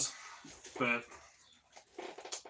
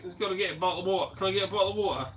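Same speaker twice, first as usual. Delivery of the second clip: 1 mock Aussie kids; 7 semitones higher, 3 dB louder, much faster than usual. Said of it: "They're like".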